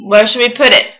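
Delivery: ordinary delivery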